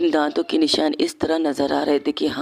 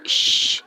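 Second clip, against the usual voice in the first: hushing; much slower than usual. Acted. Bhavna hushing Vanshika.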